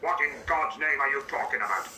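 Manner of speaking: ordinary talk, nothing marked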